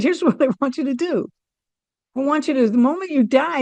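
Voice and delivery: bubbling with a laugh as you offer it; laughing, lifted. Retold or imitated.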